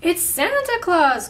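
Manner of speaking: high, loud storybook exclamation; excited cry. A narrator's reading. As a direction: cry it out as an astonished little bear. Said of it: "cried Baby Bear".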